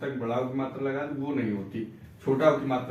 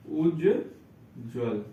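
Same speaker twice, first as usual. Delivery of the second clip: Ujjwal drawn out very slowly; teacher modelling Ujjwal slowly; slow model word; 3 semitones lower, much slower than usual. Shown as a demonstration. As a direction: stretch Ujjwal, both ज clear. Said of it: "दोनों आधा जा होगा".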